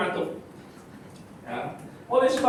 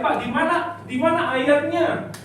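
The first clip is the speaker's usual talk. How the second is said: higher, eager student question, slowing on the last words; curious student; 9 semitones higher, 4 dB louder than usual. Pretend. Teacher voicing a student asking for the verse, eager and higher. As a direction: play a keen pupil pressing for the verse. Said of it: "kamu boleh tanya".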